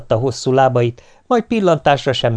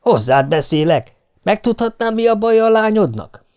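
high, raised yell at the wife; 9 semitones higher than usual. A narrator's reading. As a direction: demand her attention, exasperated. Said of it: "Ráüvöltöttem". (magas, felemelt hangú kiabálás)

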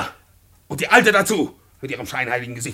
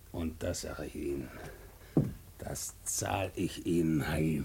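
much softer, lower, slow; quiet threat; 10 semitones lower, 13 dB quieter, slower than usual. Acted. Beaten tenant muttering revenge at the landlord, quiet. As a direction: promise revenge under your breath.

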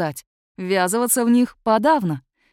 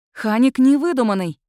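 louder, pressed emphasis; insistent; 4 semitones higher, 6 dB louder than usual. A narrator's reading. Student insisting to a sceptical Зюзер that Ханик is real, insistent. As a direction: repeat it firmly, leaning on the words. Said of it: "повторила я с нажимом".

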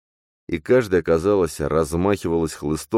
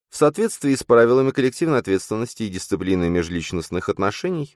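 monotone notification; 9 semitones higher than usual. Acted. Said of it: "тихий и монотонный".

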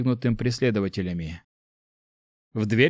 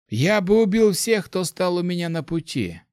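higher, matter-of-fact threat; dispassionate; 7 semitones higher than usual. A narrator's reading. Mage says how he would treat refusal, dispassionate. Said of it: "бесстрастно ответил маг".

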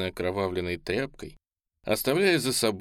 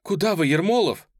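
high, loud call; raised, alarmed shout; shouted question; 9 semitones higher, 6 dB louder than usual. A narrator's reading. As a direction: cry out after someone leaving. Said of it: "крикнула Ольга".

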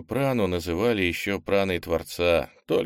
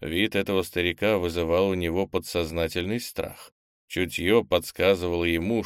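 ordinary delivery

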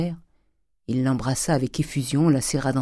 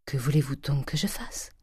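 put-on hushed, breathless whisper of a cornered old miser; 6 dB quieter than usual. Grandet whispers to the notary, avoiding his daughter's question. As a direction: murmur it under his breath, evading.

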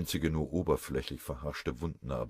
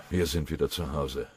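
usual speaking voice

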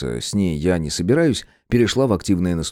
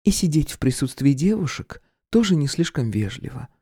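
voiced woman Irka; 7 semitones higher than usual. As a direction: pull attention back to herself. Irka chides.

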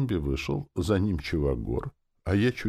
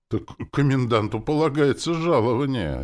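higher, louder pointed statement; reasonable claim; 9 semitones higher, 5 dB louder than usual. A narrator's reading. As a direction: state your claim up high and firmly. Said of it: "резонно заявил эльф". (резонно, с нажимом)

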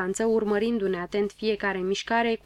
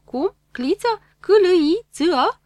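higher, louder, baffled spelling of the name; astonished spelling out; 9 semitones higher, 8 dB louder than usual. Acted. Woman baffled by the dog's odd name, spelling it out in disbelief.

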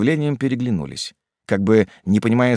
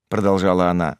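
ordinary delivery

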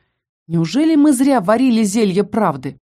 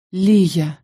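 tender whisper. A narrator's reading. Dean to the girl, tender hushed murmur. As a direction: breathe her name lovingly. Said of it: "прошептал с нежностью в голосе".

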